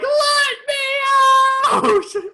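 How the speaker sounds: very high, loud, slow crying shout; mock baby scream. Newborn bursting out of the womb, crying mock shout. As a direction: wail it like a newborn.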